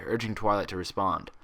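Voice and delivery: plain reading voice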